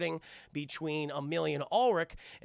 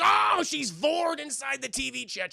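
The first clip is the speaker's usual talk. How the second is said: high, loud exclamation; mock alarmed cry; 9 semitones higher, 5 dB louder, slower than usual. Cat watcher stares at the screen, high mock-alarmed cry.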